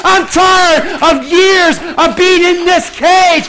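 loud, high impassioned preaching; preaching at full force. Acted. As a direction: drive it home to the congregation.

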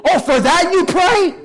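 high, loud, exasperated telling-off; shocked rebuke. Acted. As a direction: play an appalled Jesus scolding his disciples. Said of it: "He's like".

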